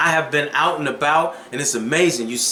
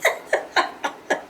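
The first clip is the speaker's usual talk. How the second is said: imitated laugh; 5 dB quieter than usual. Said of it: "He's like".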